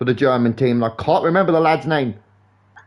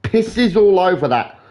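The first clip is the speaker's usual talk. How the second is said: ordinary talk, nothing marked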